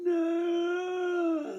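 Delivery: high desperate scream. Retold or imitated. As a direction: scream it with every bit of you. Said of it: "I screamed".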